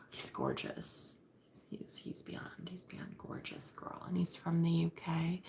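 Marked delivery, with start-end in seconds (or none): 0.0-4.0 slow, sighing whisper; swooning whisper. Real, not acted. Woman gushing over her gorgeous crush, swooning whisper. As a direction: whisper it as if overcome by his looks.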